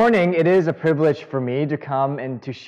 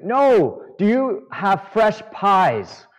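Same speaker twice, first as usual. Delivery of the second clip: yelling; 6 semitones higher, much slower than usual. Retold or imitated. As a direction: stretch every word out, shouting. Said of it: "I could hear him yelling this".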